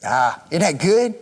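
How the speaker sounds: ordinary delivery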